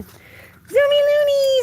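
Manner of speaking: squealed high and slow at the dog; high excited cheer. Real, not acted. Owner cheering her dog's zooms, excited high squeal.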